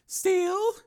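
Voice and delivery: high female character voice; woman's voice. A narrator's reading. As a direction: voice the woman, lighter. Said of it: "she said".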